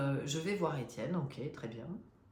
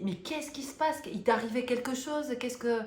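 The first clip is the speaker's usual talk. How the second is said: much higher, urgent worried questions; alarmed father; 7 semitones higher, 3 dB louder than usual. Retold or imitated. Father pleads with his shaking daughter to explain, high urgent alarm. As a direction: press her, frightened for her.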